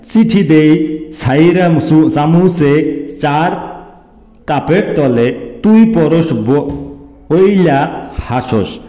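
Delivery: a forceful poem reading, words pushed out; loud recitation. Acted. Reciting a Barishal love poem to his sweetheart, loud declaiming.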